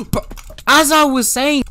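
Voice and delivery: high, loud annoyed cry; exasperated yell. Real, not acted. Player interrupted by an enemy mid-explanation, exasperated yell. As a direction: yell it when cut off mid-sentence.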